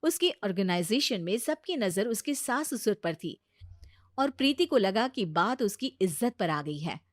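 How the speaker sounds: ordinary delivery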